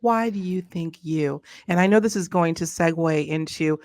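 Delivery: ordinary talk, nothing marked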